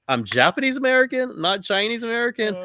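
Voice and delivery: pitched high, exasperated; exasperated correction. Retold or imitated. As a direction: set him straight in disbelief. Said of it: "You're like".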